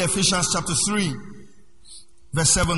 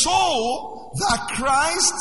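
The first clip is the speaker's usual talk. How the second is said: slow proclaimed scripture reading; 6 semitones higher, much slower than usual. Acted. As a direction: stretch every word out like a proclamation. Reads scripture slowly, proclaiming.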